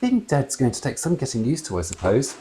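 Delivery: lightly voiced wistful mum; mild resigned mother. A narrator's reading. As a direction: let it go with a sigh.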